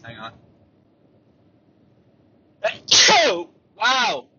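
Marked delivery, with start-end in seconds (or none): 2.6-3.5 big sudden sneeze; loud sneeze. Real, not acted. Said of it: "I need to sneeze". 3.8-4.2 surprised. Real, not acted. Reacting to own big sneeze.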